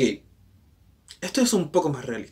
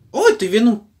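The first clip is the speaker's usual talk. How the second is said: sorprendido; 8 semitones higher, 7 dB louder than usual. Real, not acted. Reacciona a la imagen.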